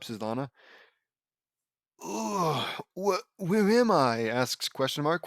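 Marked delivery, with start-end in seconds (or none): 2.0-4.3 high, slow groan of someone coming to; groggy waking. Acted. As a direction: groan and stammer as if just waking. Character waking up from unconsciousness.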